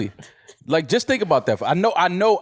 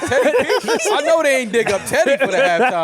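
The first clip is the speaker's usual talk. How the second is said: laughing in disbelief, pitched way up; incredulous laughing; 12 semitones higher, 3 dB louder than usual. Retold or imitated. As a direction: squeal it out, cracking up at the idea. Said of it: "I was like".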